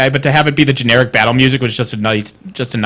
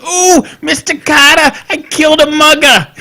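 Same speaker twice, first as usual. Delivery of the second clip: Horshack impression; 12 semitones higher than usual. Host playing a masked killer as a sitcom pupil, high eager voice. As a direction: whine it high and proudly to the teacher. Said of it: "I thought that was the voice you were doing".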